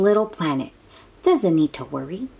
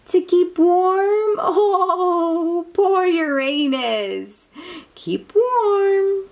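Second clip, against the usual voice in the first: tender pity; 10 semitones higher, slower than usual. Acted. Pities cold cartoon planet.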